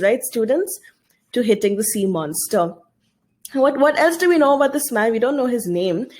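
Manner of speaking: ordinary delivery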